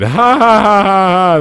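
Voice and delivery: slow, high fake laugh track; canned laugh. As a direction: ham up a sitcom laugh. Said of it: "laugh track cues in".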